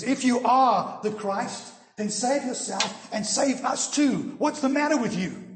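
loud, high-pitched taunting yell at Jesus; criminal's yell. Retold or imitated. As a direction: yell it as a demanding challenge. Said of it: "the one criminal yells at Jesus".

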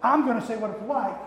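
bossy hound dog. Retold or imitated. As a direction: bark out the claim to be leader. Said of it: "no matter how much he says".

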